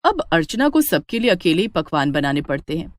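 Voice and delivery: usual speaking voice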